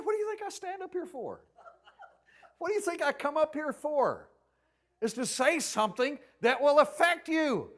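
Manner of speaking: high, loud retort; indignant retort. Retold or imitated. Heated retort to listener.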